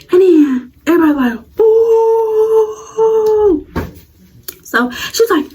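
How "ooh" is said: high drawn-out ooh; crowd ooh. Retold or imitated. Classmates reacting to the insult, high drawn ooh. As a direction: imitate the whole class reacting.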